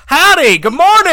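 booming, high mock-American holler; hammed-up American shouted greeting; loud outgoing American. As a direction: greet the whole street at full volume. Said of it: "so much more outgoing and loud".

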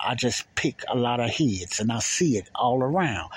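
normal talking voice

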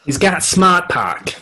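put-on slow, loud, high Boston accent "smart park" with no r; mock Boston accent from a commercial. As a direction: drop the r's like a Boston ad. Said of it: "That stupid Boston accent".

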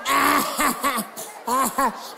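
hammed-up cry then a fading laugh; mock shriek. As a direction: mimic the crowd squealing, then dying off. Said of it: "Y'all be like".

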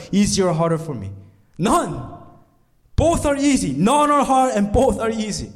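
high, driving sermon delivery; impassioned preaching. Acted. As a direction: press the point home to the crowd. Preaching builds again.

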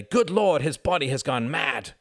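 loud, high-pitched bedside outcry; mock horrified exclamation. Tom acts out a bedside onlooker, mock horrified. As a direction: cry out in mock alarm.

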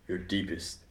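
soft, dramatic interviewer voice; dramatic hushed. Acted. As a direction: drop low for the big question. Dramatic secret question.

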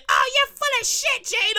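high-pitched mocking heckler; high, whiny mock accusation; imitated angry listener. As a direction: mimic a complainer in a squeaky put-on pitch. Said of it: "Somebody gonna listen and say".

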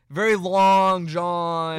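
put-on slow, drawn-out Long Island accent. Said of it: "Can you do a Long Island accent?".

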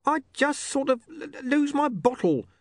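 very high, faltering excuse; nervous stammer. Acted. Charlie making excuses to Mister Pin, nervously stammering. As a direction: stumble on the word from nerves.

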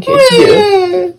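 high, slow whiny fake sob; mock crying kid. Mimicking a bratty kid's tantrum, mock crying. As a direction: whine like a tantrum child.

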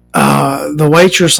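ordinary talk

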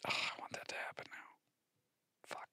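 breathy longing whisper. Real, not acted. Fan wishing for the trade, whispered. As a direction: whisper the wish like a secret.

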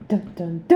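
mock dramatic dun-dun-dun; vocal suspense sting, last note held. Co-host sings a suspense sting after the announcement, mock dramatic.

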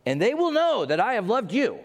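high, loud, gloating proclamation; mock vindictive reading. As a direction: read it as a triumphant I-told-you-so threat. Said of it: "I don't think that's his tone".

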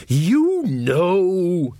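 high, drawn-out prompt; heavy hinting. Acted. Mother prompting her son to guess, hinting. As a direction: nudge the listener to catch on. Nanny hinting at forbidden name.

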